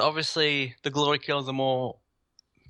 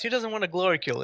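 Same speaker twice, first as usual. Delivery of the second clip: ordinary talk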